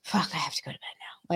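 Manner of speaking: whispered, drained; a worn-out whisper at the end of the day; exhausted. Retold or imitated. Worn out after losing her temper, an exhausted whisper. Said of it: "physically and mentally exhausting".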